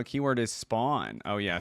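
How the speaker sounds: ordinary talk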